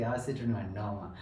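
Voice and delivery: normal talking voice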